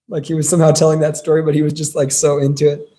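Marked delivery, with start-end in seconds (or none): none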